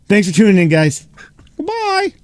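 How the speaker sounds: loud, high goodbye; cheery sign-off. Real, not acted. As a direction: sing out the farewell. Cheerful sign-off at show end.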